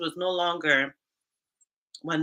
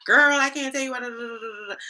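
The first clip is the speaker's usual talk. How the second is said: gossiping on the phone; 7 semitones higher, 3 dB louder than usual. Retold or imitated. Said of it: "on the phone with my girlfriend".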